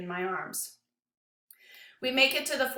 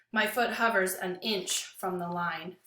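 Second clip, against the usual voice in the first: ordinary delivery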